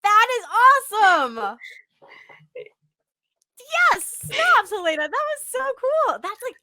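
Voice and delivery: real thrilled whooping praise; amazed cheering. Real, not acted. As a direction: burst out in loud, high amazement. Host amazed by baby-cry talent.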